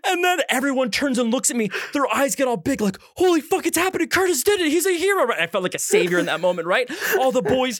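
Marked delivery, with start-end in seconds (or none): 3.1-5.2 high-pitched, excited crowd voice; awestruck crowd. Retold or imitated. Party guests seeing Curtis start the stripping, awestruck. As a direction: marvel like onlookers hailing their hero. Crowd reacts to naked hero.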